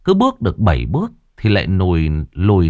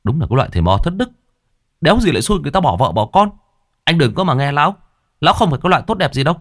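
higher, fast angry rant; angry outburst; 8 semitones higher, much faster than usual. Acted. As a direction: fire it off in a hot temper. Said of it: "Thằng Thành Mo nổi nóng". (nổi nóng, giọng cao và nhanh)

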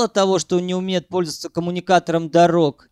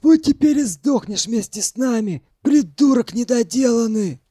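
high, venomous scolding; spitting angrily; 6 semitones higher, 3 dB louder than usual. A narrator's reading. Arkadich cursing the one who doomed them, spiteful. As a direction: spit out the insult, voice raised. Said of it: "Зло сплюнул Аркадич".

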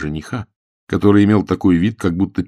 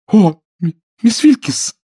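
high, loud, stumbling protest; mortified cry; 7 dB louder, slower than usual. A narrator's reading. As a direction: cry out in embarrassment. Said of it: "воскликнула Джен". (смущённый вскрик)

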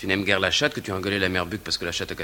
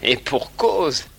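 pointed; 7 semitones higher, 5 dB louder, much slower than usual. Real, not acted. Pointed jab at wife.

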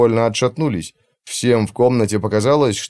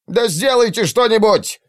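high, furious shout; yelling at doctors; 12 semitones higher than usual. Acted. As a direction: bark the order in panic. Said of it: "заорал Маслоу на врачей". (яростный крик на врачей)

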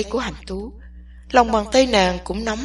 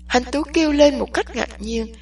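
ordinary delivery, nothing marked

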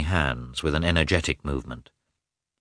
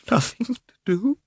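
"Nothing to do?" much higher, breaking into a cry; tearful cry. A narrator's reading. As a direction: throw "Nothing to do?" up high in anguish. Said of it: "she muttered, with tears in her eyes".

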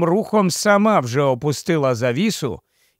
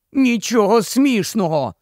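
higher, sharp indignant outburst; indignant exclaim; 6 semitones higher than usual. A narrator's reading. Runaway girl to giggling friend, indignant outburst. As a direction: cut her laughter off, offended. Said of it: "обурено вигукнула Аравіс".

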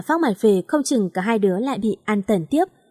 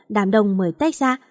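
ordinary delivery

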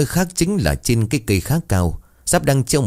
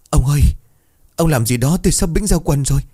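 high, louder wail to her husband; panicked pleading; 6 semitones higher, 3 dB louder than usual. Acted. Scared wife tugs husband's hand, high panicked plea. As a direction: whine in comic terror.

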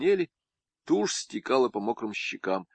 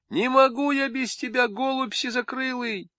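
much higher wailing village-woman voice; high melodramatic lament; lamenting wife. Acted. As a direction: plead like a heartbroken wife.

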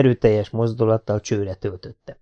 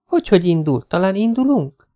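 put-on lightly higher young woman, playful; teasingly formal as a young woman; Jessica's voice; 8 semitones higher than usual. A narrator's reading. Jessica questions. (játékos, magasabb női hang)

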